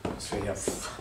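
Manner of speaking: slow, half-whispered caution; wary hush; hushed warning. Acted. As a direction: drop to a near whisper, looking around. Warns that someone could overhear.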